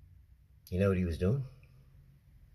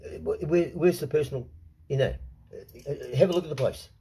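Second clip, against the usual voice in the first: angry retold rant; 3 semitones higher than usual. Telling the man off at the front door, angry. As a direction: bark it back at him, incredulous. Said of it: "I said".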